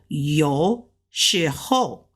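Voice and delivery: yo low, shi rising, ho falling; Mandarin tones. Shown as a demonstration. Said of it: "That means sometimes".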